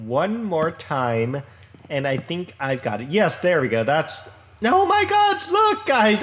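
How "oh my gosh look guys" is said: much higher, louder burst of dismay; surprised exclaim. Real, not acted. To his viewers after botching the build, surprised exclaim. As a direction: blurt it out, caught off guard by your own mistake. Blocks measured wrong again.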